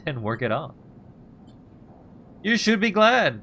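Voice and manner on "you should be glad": higher, louder, indignant outburst; rising anger. Acted. Ethan snapping at the ungrateful player, higher and louder. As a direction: let the anger break out. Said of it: "the anger rising in him now".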